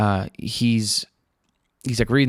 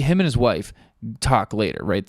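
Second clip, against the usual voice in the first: normal talking voice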